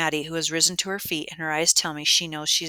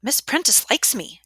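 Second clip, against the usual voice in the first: put-on shrill girl, higher and louder; 8 semitones higher, 7 dB louder than usual. A narrator's reading. Schoolgirl boasting that Miss Prentice likes her, shrill. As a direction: pipe up shrilly. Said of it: "a shrill voice answers".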